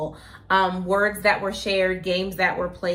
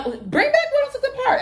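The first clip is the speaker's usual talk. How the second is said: excited; 3 dB louder than usual. Real, not acted. Excited call for old shows.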